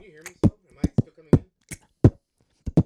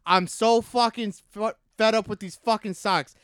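loud, high Boston sports-fan rant; fed-up Boston accent, slowed for punch; 6 semitones higher, 6 dB louder, slower than usual. Shown as a demonstration. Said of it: "Let me hear your Boston accent".

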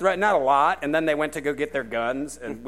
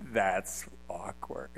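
softer, slow deadpan aside; awkward mutter; 6 semitones lower, 12 dB quieter, much slower than usual. Retold or imitated. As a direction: mumble it to yourself, slowly. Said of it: "I was like".

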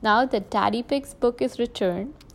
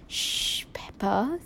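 soft, whispered shush; hushing whisper; 5 dB quieter, slower than usual. A narrator's reading. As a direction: whisper it to quiet a noisy child. Father hushing child in library.

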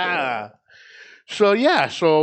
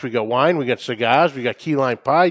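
ordinary talk

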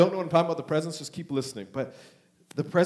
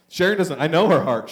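normal talking voice

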